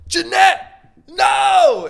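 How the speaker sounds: hammed-up screamed cry, very high and loud; dramatic acting shout. Demonstrating what acting is, hammed-up dramatic shout. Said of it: "Acting is when they're like".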